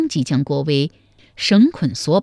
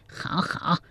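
sneering whisper; 10 dB quieter than usual. Acted. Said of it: "店家又是一声冷笑说".

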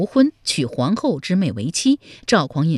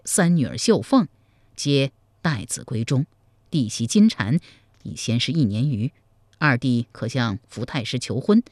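subdued, slightly lowered counsellor's voice; measured adviser Zhao Pu; 4 semitones lower than usual. Acted. Zhao Pu advising his sworn brother on a marriage plan, measured and persuasive. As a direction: lay out the plan patiently, keeping the voice down.

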